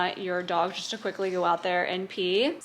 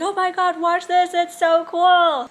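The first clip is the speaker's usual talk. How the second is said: excited; 7 semitones higher, 6 dB louder than usual. Retold or imitated. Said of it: "I was like".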